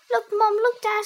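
high, loud screech. A narrator's reading. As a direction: screech for their attention. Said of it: "screeched Harry".

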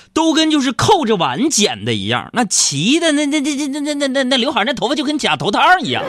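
excited. Real, not acted. Excited telling of haircut.

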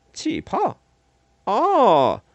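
high questioning squeak, then loud drawn-out "oh"; puzzled, then a big realizing "oh"; surprised question. Acted. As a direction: react with a sudden high, curious lift. 莱特听到气泡的反应.

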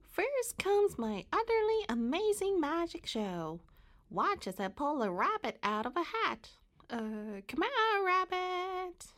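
high, squeaky magician's patter with big swoops; Mary Moo Cow. A narrator's reading. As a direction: squeakily announce each act like a showman. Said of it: "in a squeaky voice".

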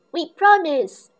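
loud, high excited promise; eager cry. Acted. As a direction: call it out eagerly like a child. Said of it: "cries Peppa".

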